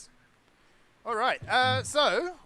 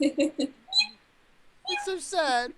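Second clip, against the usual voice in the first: mock sad; 8 semitones higher, much slower than usual. Cooing over fainting Mew.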